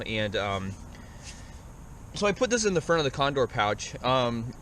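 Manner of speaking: ordinary delivery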